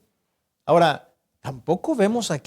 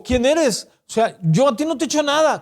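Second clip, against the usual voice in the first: higher, quick defensive protest; indignant Saul; 7 semitones higher than usual. Acted. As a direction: brush it off fast, offended. Saul imagined protesting his innocence.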